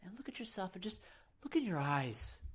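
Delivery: ordinary talk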